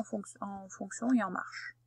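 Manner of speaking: breathy whispered aside, no voice. Real, not acted. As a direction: drop to a whisper for a quick aside. Aside while giving video instructions.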